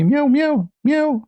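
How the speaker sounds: high squeaky cat meows; high mewing cat. Retold or imitated. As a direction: meow back like a sassy cat. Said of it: "She looked at me".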